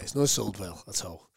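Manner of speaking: very low, soft mock resignation; resigned admission. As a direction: let it sink away, defeated. Promoter admits poor sales, reluctant exchange.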